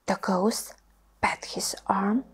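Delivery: ordinary delivery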